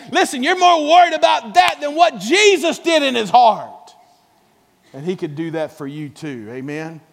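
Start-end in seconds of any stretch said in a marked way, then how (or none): 0.1-3.9 higher, louder scolding preach; fervent shout. Real, not acted. Preacher rebuking his congregation, loud high shout. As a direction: call them out at full voice.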